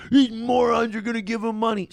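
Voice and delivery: a mock whiny hater voice; sneering impression of a complaining hater. Streamer mocking his haters in a sneering voice. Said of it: "You're like".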